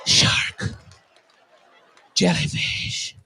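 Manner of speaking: hushed trailer-style whisper; ominous whisper. Retold or imitated. Comic retelling the movie preview, ominous whisper. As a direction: whisper each sea threat like a trailer. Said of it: "the whole preview right here".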